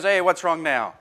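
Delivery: a fed-up mum asking a second time; exasperated mother. Retold or imitated. Mother asks again, exasperated.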